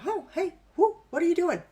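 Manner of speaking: the brain caught off guard, asking what's going on; surprised brain. Retold or imitated. Instructor voicing the brain puzzled by the other hand, surprised. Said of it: "your brain's going".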